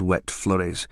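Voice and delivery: ordinary delivery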